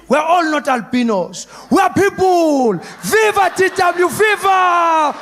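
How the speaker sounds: high, loud, drawn-out chant; rousing rally cry. Acted. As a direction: proclaim it like a crowd hailing its hero.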